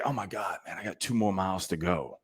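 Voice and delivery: quiet, imitated inner groan; hushed self-talk. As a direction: say it under your breath, as if thinking mid-run. Acts out self-talk on a run.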